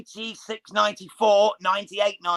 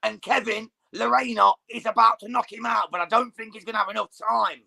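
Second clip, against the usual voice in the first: excited commentary, higher and rising at the bell; live play-by-play; 4 semitones higher than usual. Real, not acted. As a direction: call the last seconds of the round live. Live round-end commentary.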